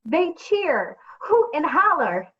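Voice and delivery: usual speaking voice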